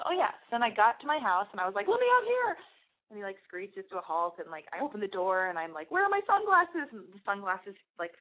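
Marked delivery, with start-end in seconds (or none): 1.9-2.6 very high mock-panicked outburst; hammed-up, high-pitched plea; panicked demand. Retold panicky escape from car. 5.9-6.9 high, mock-frantic question; hammed-up high-pitched fluster; frantic searching. Retold frantic search for sunglasses.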